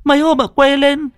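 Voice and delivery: high, fast, stammering explanation; frightened rush. Acted. Hằng telling Dung about the nightmares, frightened. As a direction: pour it out in fear. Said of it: "Hằng kinh hãi".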